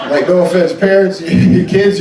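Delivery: usual speaking voice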